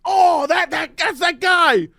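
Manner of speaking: high, slow, gushing mock delight; imitating a friend spotting actors during a movie, mock excited. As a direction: point out every actor like a thrilled kid. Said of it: "you were like".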